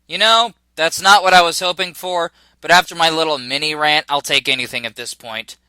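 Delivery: usual speaking voice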